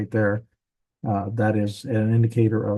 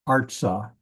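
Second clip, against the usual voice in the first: ARtsah, first syllable stressed, last one light; accent the start of "Artsah", leave the ah unaccented; stress on Artsah's first syllable. Shown as a demonstration. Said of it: "notice that the accent".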